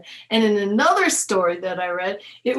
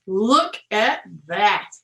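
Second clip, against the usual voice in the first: plain reading voice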